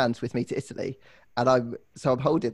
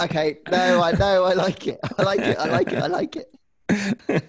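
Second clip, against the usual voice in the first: holding back laughter; trying not to laugh; stifled giggles; 5 semitones higher than usual. Real, not acted. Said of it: "You're both trying not to laugh".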